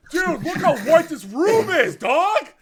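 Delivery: loud, high hype-man vlog voice; hyped vlogger. Retold or imitated. Husband vlogging in the delivery room, loud and hyped. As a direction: play the clueless vlogger showing off. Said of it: "Just vlogging".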